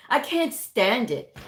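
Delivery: usual speaking voice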